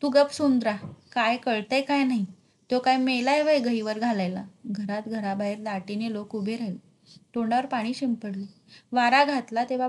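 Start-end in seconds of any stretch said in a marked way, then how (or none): none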